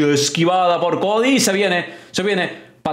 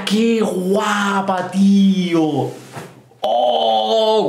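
ordinary talk, nothing marked

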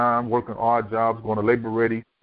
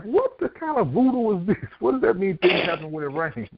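imitating his own baffled question, pitched up; incredulous; 7 semitones higher than usual. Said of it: "And I'm like".

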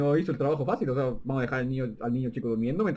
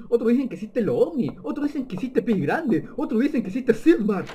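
building excitement; 8 semitones higher, 5 dB louder than usual. Real, not acted. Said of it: "building list".

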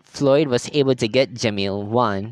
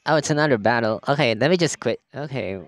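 ordinary talk

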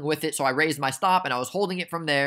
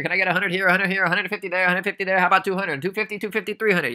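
playful mock auctioneer, higher pitch; auctioneer patter; 5 semitones higher than usual. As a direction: rattle off bids like a hammy auctioneer. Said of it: "I sound like an auctioneer".

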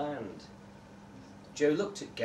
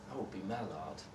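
quiet, wistful; 6 semitones lower, 12 dB quieter than usual. Acted. Joe quietly answers.

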